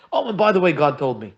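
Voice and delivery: blurted out loud and high, as an afterthought; tacked-on afterthought. Retold or imitated. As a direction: toss off the key news last, loudly. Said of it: "What are you going through a long spiel for?".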